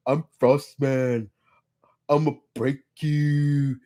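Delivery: put-on slow, slurred Austrian muscleman accent; lightly imitating a drunk Arnold; drunken Arnold Schwarzenegger. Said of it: "he sounds like a drunken Arnold Schwarzenegger".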